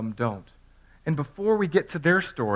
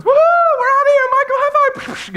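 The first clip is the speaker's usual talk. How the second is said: squeaky falsetto, far higher and louder, gleeful; nerdy little angels; 10 dB louder than usual. Retold or imitated. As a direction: cheer like a giddy little nerd angel getting away. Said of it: "two little nerdy angels".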